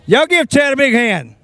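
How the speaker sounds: loud, high announcer call; crowd call. Real, not acted. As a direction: rouse the crowd.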